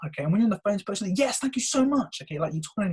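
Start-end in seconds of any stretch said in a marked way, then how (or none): 1.2-2.2 high, slow gushing thanks; smiling gratitude. Shown as a demonstration. Coach thanking a caller on the phone, smiling and gushing. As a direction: thank them with an audible smile. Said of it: "you've got a smile".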